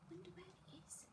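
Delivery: soft secret whisper. Acted. Reader teasing the child about where the notebook is, whispering. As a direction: lean in and whisper the mystery.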